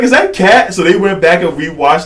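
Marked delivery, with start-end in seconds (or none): 0.0-0.7 high, loud imitated disbelief; mock astonishment. Retelling friends spotting Kat in the video, mock astonished. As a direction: exclaim it as an amazed onlooker.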